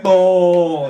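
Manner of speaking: put-on loud, high, blunt accented yelp; Eastern European moan. Eastern European porn actress yelping during sex.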